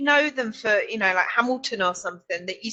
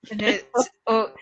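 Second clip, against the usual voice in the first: ordinary delivery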